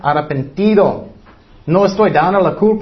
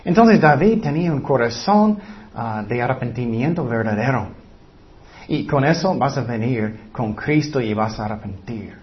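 usual speaking voice

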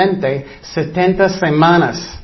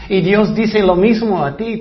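ordinary delivery

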